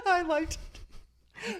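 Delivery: laughing while talking. Real, not acted. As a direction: blurt it out through laughter. Laughing at herself.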